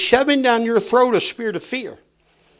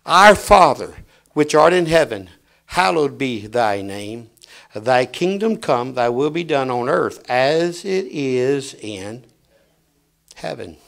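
lower, softer, slow prayer; prayerful hush; 6 semitones lower, 3 dB quieter than usual. Pretend. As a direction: pray it low and slow. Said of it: "and that's just it".

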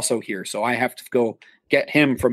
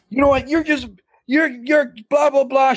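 retold screaming; 11 semitones higher than usual. Drunk actor screaming at the manager, mock angry yell. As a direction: imitate a drunk woman screaming at you. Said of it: "starts screaming at me".

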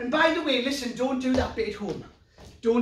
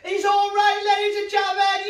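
triumphant shout; 8 semitones higher, 6 dB louder than usual. Acted. Triumphant announcement to audience.

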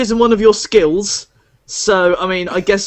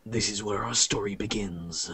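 slow, grand storyteller intro; grand intro narration; much slower than usual. Acted. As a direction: announce the heroes like a tale begins. GM introduces the heroes.

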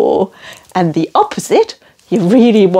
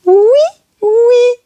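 oui oui sung high and cheerful like a cartoon name; Noddy's name; 3 dB louder than usual. Shown as a demonstration. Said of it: "the character is called".

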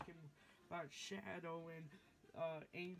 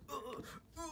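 strained whisper; 9 semitones higher, much faster than usual. Acted.

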